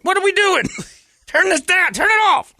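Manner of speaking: very high, loud panicked yelp; mock panic. His brain panicking at the TV, very high and loud. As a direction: yelp it in horror at the screen. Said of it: "my brain's like".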